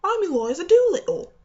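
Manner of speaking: put-on shrill, loud Cockney flower girl voice, "I'm Eliza Doolittle"; Cockney accent. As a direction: belt it out like a cheeky Cockney with a glottal stop. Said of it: "a specific British accent such as Cockney".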